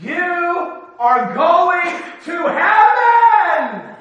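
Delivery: booming joyful declaration; triumphant shout. Real, not acted. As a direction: rouse the congregation with joy. Preacher's triumphant climax.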